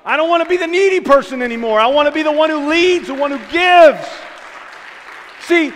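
loud, high fervent preaching; preaching at full force. Real, not acted. Preacher rallying the church with loud, high fervor.

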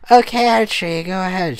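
high, loud and bubbly; hammed-up perky girl; perky exclaim. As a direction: chirp it like an overeager cheerleader. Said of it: "she exclaimed".

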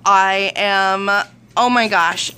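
normal talking voice

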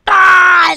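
loud joking shriek; hammed-up yell; mock scream. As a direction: let out a fake scream. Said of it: "what the hell was that noise".

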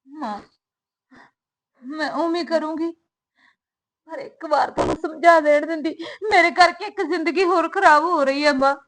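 crying while begging; weeping plea. Acted. Daughter begging her mother for one chance, weeping. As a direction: sob out the request.

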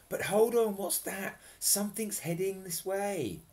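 a small fairy peering ahead, alert. Acted. Fairy spots something coming, a lightly voiced fairy. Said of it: "she said".

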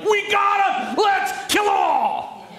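wildly excited high shout, last words drawn out. Acted. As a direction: whoop it high, like a thrilled king. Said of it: "He gets so excited. He's like".

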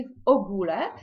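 "в огуле" stressed on the O of огуле; exceptional Polish stress. Shown as a demonstration. Teacher listing exceptions to the stress rule, stress on O. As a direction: hit the first syllable of "огуле". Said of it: "не згідно правил розставляються".

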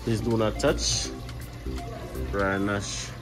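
ordinary talk

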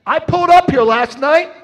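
loud, high rallying cry; full-force preaching. Real, not acted. Preacher urging the crowd to give, loud rallying cry. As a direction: whip up the crowd.

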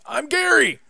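high, loud goblin yell of its own name; goblin shout. Acted. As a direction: shriek the name like an excited goblin. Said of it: "Goblin shouts".

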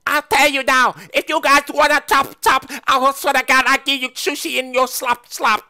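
high, loud raving threat; insane outburst. Acted. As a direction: lose it and yell threats. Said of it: "went, like, insane just now".

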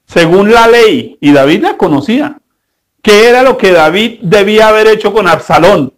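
normal talking voice

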